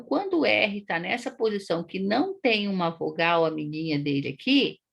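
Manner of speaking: usual speaking voice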